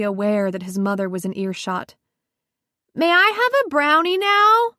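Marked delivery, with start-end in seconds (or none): 2.9-4.7 put-on high child's voice, calling out; a hopeful little boy asking loudly; young boy. A narrator's reading. Six-year-old son calling from the kitchen for a brownie, high and loud. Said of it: "Gabe yelled from the kitchen".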